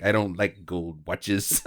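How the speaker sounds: lightly voiced tough guy, low and slow; a hint of a quiet, deadpan tough guy; Charles Bronson. Bronson turns down Duke's bribe, lightly voiced low and slow. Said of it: "Charles Bronson's like".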